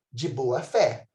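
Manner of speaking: usual speaking voice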